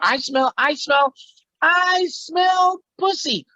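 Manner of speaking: very high, slow drawn-out catchphrase; cartoonish high-pitched sniffing-out announcement; Pee-wee. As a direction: stretch each word in a squeaky character voice. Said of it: "And another part".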